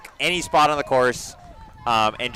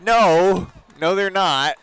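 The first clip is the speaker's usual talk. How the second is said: mock retort; 6 semitones higher, 5 dB louder, much slower than usual. Retorting runner's boast on sideline.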